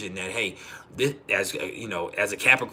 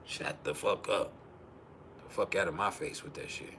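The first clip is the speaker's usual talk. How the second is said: low dismissive retort; 5 semitones lower, 10 dB quieter than usual. Retold or imitated. Husband dismissing wife's excuses in a retold spat, quietly dismissive. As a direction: say it low and under control, dismissively.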